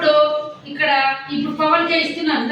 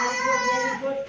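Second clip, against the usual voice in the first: high, slow, singsong "very good"; gushing, slow praise pitched up high; drawn-out praise; 9 semitones higher, 5 dB quieter, much slower than usual. Real, not acted. Teacher praising child.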